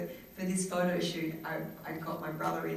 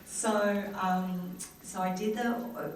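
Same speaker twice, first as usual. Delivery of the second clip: ordinary talk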